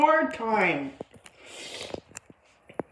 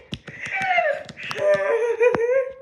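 giddy high laugh; 11 semitones higher than usual. Acted. Giddy laugh after cheering.

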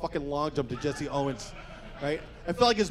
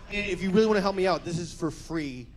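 ordinary delivery

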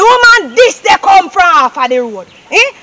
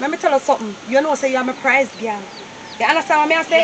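stern mother; 5 semitones lower, 9 dB quieter, faster than usual. Acted. Mother lectures her prize girl.

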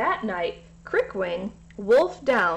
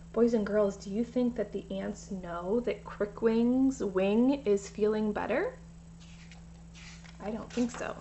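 soft aside; 6 dB quieter than usual. A narrator's reading. Reader asks the children directly.

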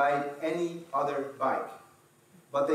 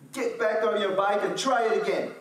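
lightly higher, louder firm command; dad's plain order; 7 semitones higher, 5 dB louder than usual. Retold or imitated. A hint of the dad calling down from the hill, firm. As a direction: say it like a dad who won't come help. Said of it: "and he said".

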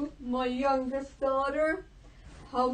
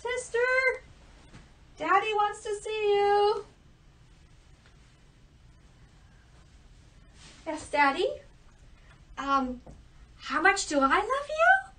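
put-on higher, sing-song girlish voice; giddy second daughter; 5 semitones higher than usual.

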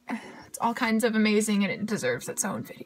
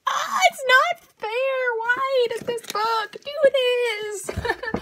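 high, loud anguished complaint, slowing down; anguished whine; 11 semitones higher, 3 dB louder, slower than usual. Real, not acted. Fan cries out at the book over Peony's death. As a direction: cry out at the unfair book.

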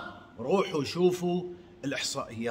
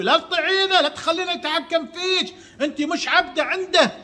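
pitched-up mock of the agitators, louder; hammed-up high mockery of the agitators' advice; 12 semitones higher, 5 dB louder than usual. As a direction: sneer it out high as a mock quote. Mocking what agitators tell wives.

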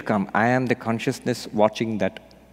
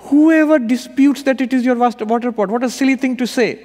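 much higher, louder scoffing; scoffing king; 13 semitones higher, 7 dB louder than usual. Retold or imitated. King scoffing at the sage's silly chant, high loud scoff. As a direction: scoff at the obvious. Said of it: "king scoffs".

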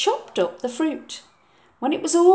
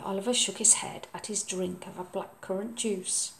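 plain reading voice

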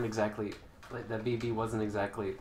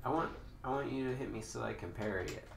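ordinary talk, nothing marked

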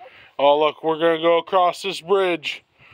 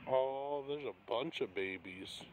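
hushed; 3 semitones lower, 16 dB quieter than usual. Real, not acted. Sneaking up on baby geese.

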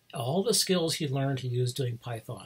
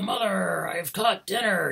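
higher-pitched mock ogre call, drawn out at the end; ogre calling to mother; 6 semitones higher, 3 dB louder, slower than usual. Retold ogre calls mother about the catch, calling out. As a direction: sing out to mother like a pleased ogre.

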